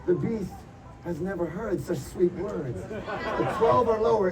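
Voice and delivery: normal talking voice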